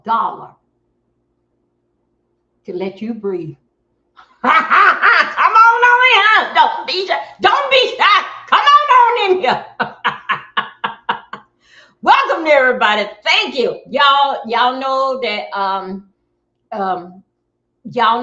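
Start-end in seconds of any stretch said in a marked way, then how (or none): 4.4-9.7 high, loud drawn-out holler; calling out. Real, not acted. Streamer beckoning shy viewers to come on in, calling out. 9.7-11.4 long run of cackling ha-ha-has; laugh. Real, not acted. Streamer laughing after calling viewers in, laugh. 12.0-13.8 loud, lifted greeting; loud welcome. Real, not acted. Streamer welcoming everybody to the live, loud welcome. Greeting arriving viewers loudly.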